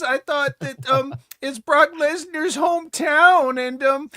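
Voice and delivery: high, mock-sincere sarcastic voice; hammed-up troll post read aloud. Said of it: "That sounds like an A I Twitter troll voice".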